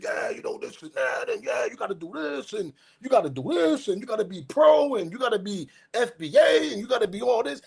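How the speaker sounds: high, sing-song imitation of preachy internet experts; mocking the know-it-alls. Vlogger imitating internet experts telling people what to do, high mocking sing-song. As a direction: lecture in a mock-preachy voice.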